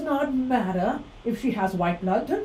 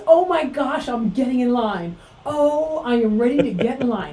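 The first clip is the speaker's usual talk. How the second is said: excited; 5 semitones higher, 7 dB louder than usual. Acted. Mock eager to demand an apology.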